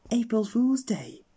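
high, slow, loud reveal; gleeful punchline. Acted. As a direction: land the joke with glee. Policeman delivers the punchline.

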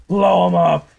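loud rallying shout; urgent shout. Real, not acted. Gamer shouting to teammates to blow up an enemy. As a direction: shout the order to your team.

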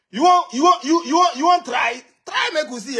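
mocking shouted dare; taunting shout. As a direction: dare him to try it, yelling. Mocking a challenge to fight.

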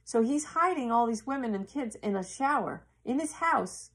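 normal talking voice

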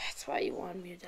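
breathy whisper as it dawns on you; hushed realisation. Real, not acted. Realising he was set up.

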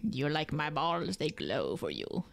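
very low, slow creature voice; creepy bug voice. Pretend. Bug showing off its glow, deep slow creature voice. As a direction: sink deep and offer it slowly, creepily.

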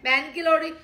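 imitating a disgusted man yelling to get out; mock angry shout. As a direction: bark him out the door. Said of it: "फिर कहेगा".

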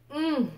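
a loud, high mm of delight; tasting hum, pleased; savoring mm. Real, not acted. First bite of food.

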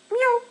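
very high, loud cat meow; mock meow. Gamer imitating Mewtwo's cry, very high mock meow. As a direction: squeak out a tiny cat call.

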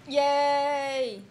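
cheer. Real, not acted. Praised for her pronunciation.

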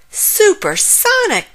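loud, high cheer. A narrator's reading. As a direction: cheer the word out in delight. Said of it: "cheered Frog".

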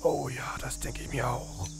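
soft whispering. Real, not acted. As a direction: hush it to a whisper. Nach dem Unfall, leise. (geflüstert)

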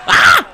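high, loud sudden ah; startled yell. Real, not acted. Gamer reacting to a blow, startled high yell. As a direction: yelp sharply at the impact. Hit in the fight.

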